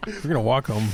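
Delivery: laughing. Real, not acted. Joking threats through laughter.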